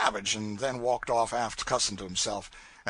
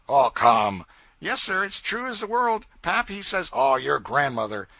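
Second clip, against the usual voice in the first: much louder disbelieving scoff; 3 semitones higher, 7 dB louder than usual. Acted. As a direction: jeer at the tall tale. Crew scoffs at the boy.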